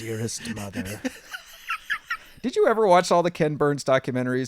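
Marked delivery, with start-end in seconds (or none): 0.0-1.0 low, soft, slow letter reading with a laugh; parodying voiceover letters in a war documentary; mock documentary voiceover. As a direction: hush it like a solemn period narrator.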